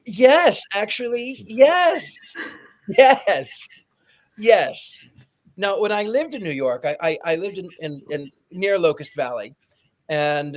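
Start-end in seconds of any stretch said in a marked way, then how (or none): 0.0-5.0 loud, high insistent yes; emphatic yes. Retold or imitated. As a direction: answer with firm, rising insistence. Emphatic answer to the mayor.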